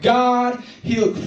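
high, drawn-out mock cry of joy; imitated tearful testimony. Healed girl testifying to the crowd, imitated tearful cry.

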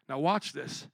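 ordinary talk, nothing marked

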